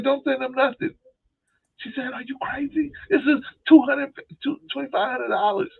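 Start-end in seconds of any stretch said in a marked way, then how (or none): 0.0-0.9 high mock voice; man warning his sister-in-law, high mock voice; imitating himself warning. 2.1-5.7 high mock protest; imitating indignant sister.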